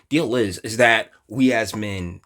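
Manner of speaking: usual speaking voice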